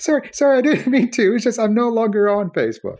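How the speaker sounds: put-on high, apologetic little-kid voice; seven-year-old self. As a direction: plead like a child in trouble. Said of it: "the seven-year-old in me came out".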